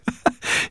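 short breath of happy laughter; delighted laugh. A narrator's reading. Professor overjoyed at his new son, short delighted laugh.

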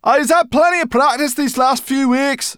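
high, loud creature voice, mouth busy; chewing while talking. A narrator's reading. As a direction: talk through noisy chewing. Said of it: "chewing noisily".

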